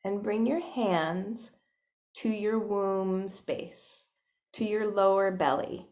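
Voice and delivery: soft, unhurried meditation voice with long pauses; hushed, spacious guidance into breath and body; guided meditation. Real, not acted. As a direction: gently guide listeners inward, leaving space between phrases.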